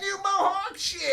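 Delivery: very high, cracking hustler voice; squeaky hustler voice. Retold or imitated. Little hustler reacting to the narrator's answer.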